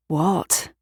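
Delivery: usual speaking voice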